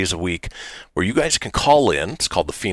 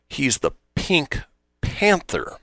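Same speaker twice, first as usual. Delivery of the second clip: slow, pause before a higher-pitched Panther; slowed-down repeat; 7 semitones higher, 3 dB quieter, slower than usual. Acted. Respondent to someone who doesn't get it, slowly. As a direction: spell it out word by word, slowly. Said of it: "Say that again, but slower".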